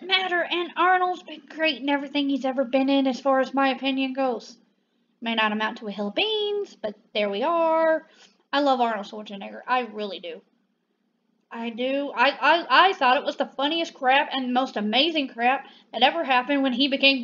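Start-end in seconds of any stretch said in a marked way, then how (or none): none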